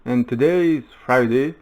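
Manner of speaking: punchy, swooping host intro; show-opening announcement. Acted. Host greeting the world on a daily talk show, announcing. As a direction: open the show with big, rising-and-falling emphasis.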